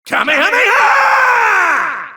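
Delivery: high, straining kamehameha shout; charging a beam attack, screaming. Acted. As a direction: scream the attack out at full stretch. Battle cry in fight game.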